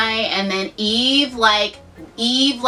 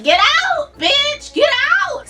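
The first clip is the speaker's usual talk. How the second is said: mock God yelling; much slower than usual. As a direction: scream them out of the garden. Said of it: "God's like".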